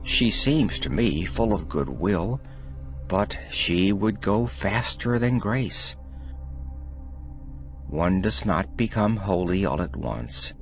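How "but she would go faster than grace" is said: usual speaking voice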